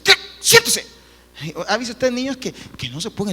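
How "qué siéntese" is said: high, loud order to sit down; parent snapping. Retold or imitated. Parent ordering a fidgety child to sit, loud and high. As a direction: bark it at a restless child. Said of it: "lo empieza a decir".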